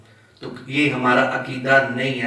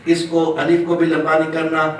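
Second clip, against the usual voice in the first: normal talking voice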